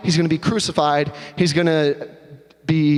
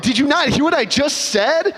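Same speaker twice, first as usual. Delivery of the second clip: higher, can't believe it; exasperated disbelief; 8 semitones higher than usual. Acted. Husband to wife who ignored his news, higher exasperated disbelief. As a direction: push back, stunned.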